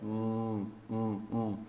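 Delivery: soft hum held on M; closed-lip M. Shown as a demonstration. Teacher isolates the M, soft closed-lip hum. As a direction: lips shut, let hum drone softly. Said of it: "Então esse som aqui".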